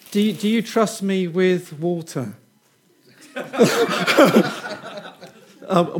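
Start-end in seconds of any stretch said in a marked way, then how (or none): 3.3-5.3 short uneasy giggle; nervous laugh. Real, not acted. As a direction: laugh from nerves, unsure. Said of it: "was that a, just a, a nervous laugh or a yes".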